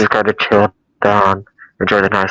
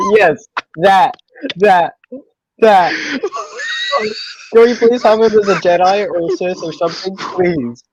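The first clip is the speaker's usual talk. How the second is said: excited; 10 semitones higher than usual. Real, not acted. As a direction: squeal with delight at the idea. Excited agreement after laughing.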